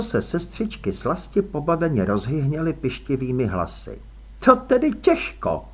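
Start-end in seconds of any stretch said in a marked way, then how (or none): 4.4-5.6 put-on higher, brisk no-nonsense young woman; young woman, firm. A narrator's reading. Růžena urges Elánius back, firm young woman. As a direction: voice Růžena as sharp and sure.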